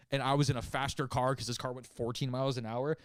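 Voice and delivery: usual speaking voice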